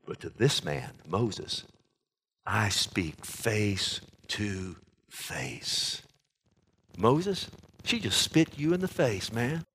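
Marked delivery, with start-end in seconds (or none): 0.0-6.0 slow, lower and softer, solemn; God speaking with hushed gravity. Retold or imitated. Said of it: "He says".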